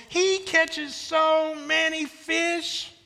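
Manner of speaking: every word of "He catches so many fish" stretched high; very slow, high, hammed-up "so many fish"; milk each word for the crowd; drawn-out emphasis. Shown as a demonstration. Final exaggerated repeat for crowd.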